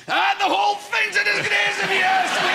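loud high grunt covering the effort; strained outburst. Retold or imitated. Old man masking his groan with loud complaint. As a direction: bluster loudly to mask the strain. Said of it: "And then you go".

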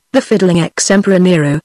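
plain reading voice

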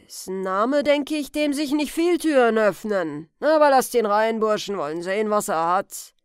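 put-on loud, weighty old man's grumble; grudging old man. Old man telling his sons to admit Huck, loud and grudging. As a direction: order the lads while doubting the visitor.

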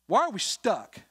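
fervent pulpit challenge; preaching challenge. Acted. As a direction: call the church out. Repeated rhetorical question, rising.

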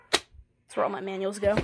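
usual speaking voice

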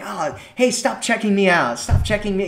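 flirting with a guy caught looking, playfully; playful flirty. Shown as a demonstration. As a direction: tease him like it's a game. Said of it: "doing it in a fun, kind of flirty kind of way".

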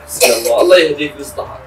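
exasperated. Acted. Father giving up on his son, loud and exasperated. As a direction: cry it out in despair.

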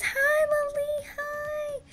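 high, sweet hello to a fan; delighted high-pitched hi; squealy greeting. Real, not acted.

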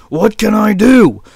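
fed-up sick sheep voice; complaining sick sheep. Acted. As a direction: wail loudly about the flu. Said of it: "he mumbled".

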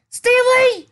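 shrill, high, very loud scream. Acted. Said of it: "she screamed".

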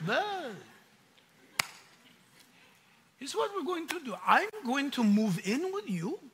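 a lightly voiced wry James, knowing and teasing. James tells the believer he'll watch him, lightly voiced.

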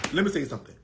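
normal talking voice

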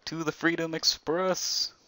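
slow, high, grandly announced; grand announcer. Pretend. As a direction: proclaim it like a conductor. Announcing escape route grandly.

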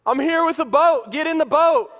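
high, loud insistent shout; acted rescuer's yell; exasperated calling out. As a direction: shout orders to a stubborn man. Acted boatman insisting again.

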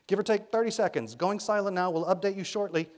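high, loud radio shout; young woman shouting into a radio. A narrator's reading. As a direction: keep yelling the update over wind. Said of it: "shouted the young woman".

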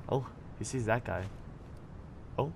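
usual speaking voice

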